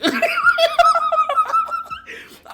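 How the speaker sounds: loud, very high cackle; shrieking laugh. Real, not acted. Vlogger cracking up at own betrayal joke, loud squealing laughter. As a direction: burst into long squealing laughter.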